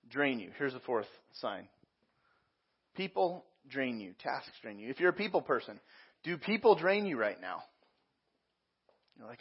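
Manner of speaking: ordinary delivery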